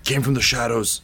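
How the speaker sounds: dying man warns the queen of the Shadow Mother; dying warning. Acted. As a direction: force the warning out with failing breath. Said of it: "and he's like".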